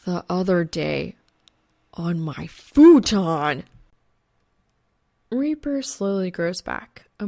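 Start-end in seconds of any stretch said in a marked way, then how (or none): 0.0-3.6 slow, loud, much louder cry of horror; a slow shocked shout; horrified outburst. Acted. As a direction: blurt it out in horror, dragging the words.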